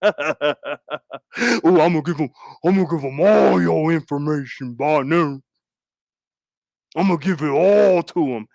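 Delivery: a low, put-on mocking voice. Mocking a hater who threatens to leak his info, low put-on voice. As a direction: sneer out a lightly voiced mimic.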